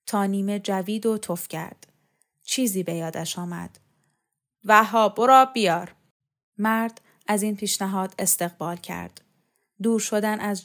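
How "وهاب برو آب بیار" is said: loud, commanding call; brisk raised-voice command; called-out order. A narrator's reading. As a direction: shout an errand across the yard. Calls out an order to Vahab.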